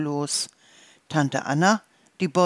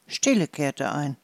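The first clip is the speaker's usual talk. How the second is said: plain reading voice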